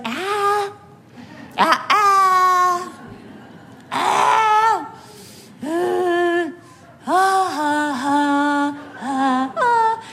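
high wails petering out; imitated tantrum cry, running out of steam; mock toddler wailing. Mother imitating her child's meltdown winding down, wailing. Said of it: "pretty soon she's going".

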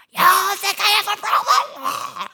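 high, loud, slow indignant snap; furious retort. Retold or imitated. Wife retelling how she lashed out at her husband, loud and high. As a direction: throw the question back at your husband in rage. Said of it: "And I'm like".

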